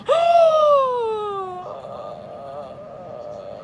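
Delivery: long, high, crying gasp of disbelief; a drawn-out stunned gasp, half sob; shocked gasp. Retold or imitated. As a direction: gasp like the floor just dropped. Reading termination letter shocked.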